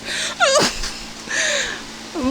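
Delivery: high-pitched laugh at herself; real laugh. Real, not acted. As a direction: burst into a high, self-mocking laugh. Laughs at her own demonstrated look.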